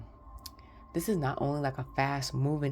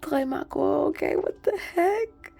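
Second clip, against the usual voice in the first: high, louder burst of disbelief; astonished; 10 semitones higher, 5 dB louder than usual. Real, not acted. Tarot reader spotting watchers in the cards, astonished. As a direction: react in real amazement.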